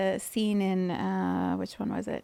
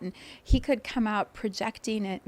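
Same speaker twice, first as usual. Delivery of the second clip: usual speaking voice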